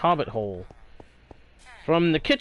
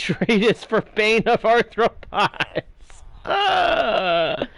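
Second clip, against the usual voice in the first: laughing; 6 semitones higher, much slower than usual. Real, not acted. Laughing at a bad trade.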